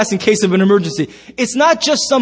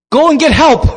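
loud, high, urgent call; mock-alarmed shout; acted cry for help; 5 semitones higher, 6 dB louder than usual. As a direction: cry out as a frightened wife. Wife cries out as toughs harass.

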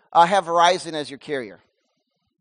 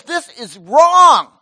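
loud, slow shouted rebuke, higher; God's rebuke; 6 semitones higher, 12 dB louder, much slower than usual. Acted. God rebuking the listener, loud slow shout. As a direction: thunder it out as God's voice. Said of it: "When God says".

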